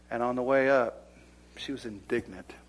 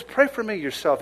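higher, pressing plea; desperate plea; 9 semitones higher, 7 dB louder than usual. Acted. Simon begging the apostle, higher and desperate. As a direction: throw it out like a desperate man. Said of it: "he's real desperate".

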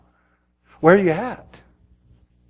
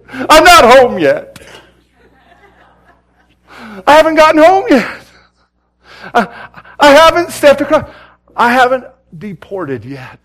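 high, loud preacher's re-enactment; impassioned outcry; 9 semitones higher, 11 dB louder than usual. Retold or imitated. Missionary tells his wife what the Lord said, impassioned cry. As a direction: cry it out high and drawn, easing at the end. Missionary relays God's answer, crying out.

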